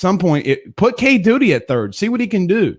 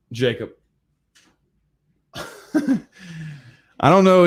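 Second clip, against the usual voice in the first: ordinary delivery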